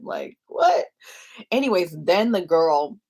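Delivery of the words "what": high, loud mock "what"; retold burst of bafflement; incredulous. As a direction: pitch it up in disbelief. Retold disbelief at the women's assumption.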